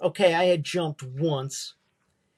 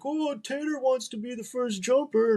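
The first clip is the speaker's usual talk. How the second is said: high mocking sing-song, ribbing a friend; teasing buddies; 7 semitones higher than usual. Buddies teasing Tater about first jumper, high mocking voice. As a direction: needle him like a taunting buddy. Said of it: "my buddies are like".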